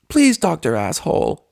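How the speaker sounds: high pleading please; beg, trailing up at the end; Pinkie pleading. Acted. Pinkie mangles the doctor's name, pleading high.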